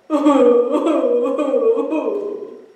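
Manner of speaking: loud, far-off bawling sob; demo sobbing. Shown as a demonstration. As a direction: bawl like a big bear crying. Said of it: "Something like this".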